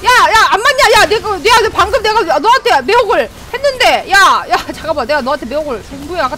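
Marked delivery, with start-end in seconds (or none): none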